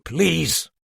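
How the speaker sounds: a curt, irritated bark; the detective snaps at the others to stop arguing; snapped. A narrator's reading. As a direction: cut them off sharply. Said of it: "snapped the detective".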